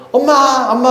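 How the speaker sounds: put-on high, eager child's call; a little girl calling out for mom. Child calling her mother. (엄마를 부르는 아이의 높은 목소리)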